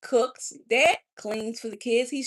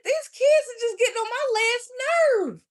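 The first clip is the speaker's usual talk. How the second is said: high, loud wailing complaint; tearful exasperation; 8 dB louder than usual. Retold or imitated. Frazzled mom about her kids, loud tearful wail. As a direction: wail it as an overwhelmed mother. Acts out the overwhelmed young mother.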